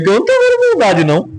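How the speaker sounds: high, fast incredulous scoff; mock disbelief. Preacher mocking disbelief at Boaz's praise, high and fast. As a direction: scoff as if the kindness can't be real.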